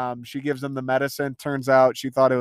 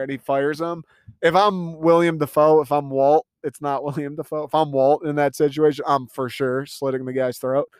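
usual speaking voice

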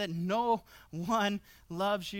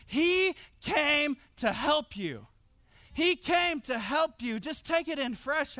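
higher, louder, slow pulpit preaching; preaching at full force; 4 semitones higher, 3 dB louder than usual. Acted. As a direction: preach it out, stretching the key lines. Preaching climax, raised voice.

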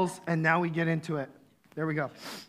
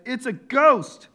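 terrified cry; 7 semitones higher, 9 dB louder, slower than usual. Acted. Disciples terrified on the water.

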